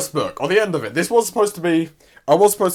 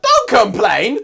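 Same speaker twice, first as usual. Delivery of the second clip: snappy Power; 7 semitones higher, 7 dB louder, much slower than usual. Retold or imitated.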